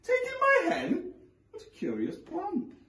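put-on high, incredulous old woman; old lady voice. A narrator's reading. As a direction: squeak it like a puzzled granny.

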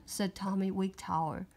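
ordinary delivery, nothing marked

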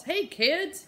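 high, hearty greeting; Papa walking in from the backyard, booming hello; booming dad. Acted. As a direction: call out cheerfully to the kids. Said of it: "he boomed".

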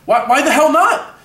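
wounded star protesting; indignant. Acted. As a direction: argue your case in outrage. Rejected actor argues for himself.

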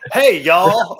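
loud, high Southern holler; mock Southern drawl. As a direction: call it out in a hammed-up Southern accent. Teasing demo of Southern accent.

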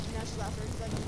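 plain reading voice

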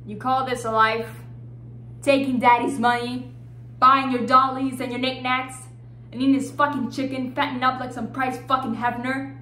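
loud, scornful tirade; angry berating. Acted. Woman attacks friend's life on daddy's money, loud scorn.

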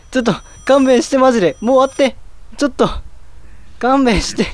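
high-pitched begging for mercy; panicked pleading. Real, not acted. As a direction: plead with the game to let it end. Begging scary game to stop.

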